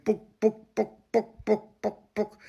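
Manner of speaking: high, pattering imitation of dropping bombs; playful high mimicked blasts; mock bomb noises. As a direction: rattle off the sound of falling bombs. Mimicking the bombing sounds.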